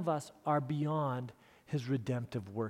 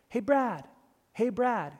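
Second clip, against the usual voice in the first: lightly acted higher-pitched hail; calling out; 7 semitones higher, slower than usual. Lady hailing a boy on the sidewalk, imitated calling out. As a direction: call to him down the street, lightly imitated. Lady calls after Brad in story.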